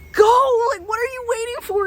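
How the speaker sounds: high, loud shove of Go; urging friend. Retold or imitated. Her friend urging her toward the star, high and loud. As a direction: push her to go, impatient. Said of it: "She's like".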